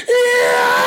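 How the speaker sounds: high loud cry of joy; ecstatic yell. Retold or imitated. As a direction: scream it as the ball goes in. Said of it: "I was just like".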